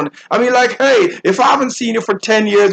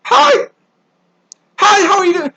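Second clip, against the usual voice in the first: high-pitched, phony pleasantry; pre-calculated simpering; 10 semitones higher than usual. Retold or imitated. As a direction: mimic a fake, gushing hello. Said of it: "it is so pre-calculated".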